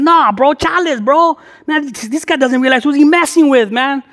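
mock-furious homeboy rant; faked gangster anger. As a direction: act insulted and ready to fight. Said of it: "put in my old gangster face, all mad dogging".

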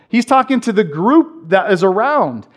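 usual speaking voice

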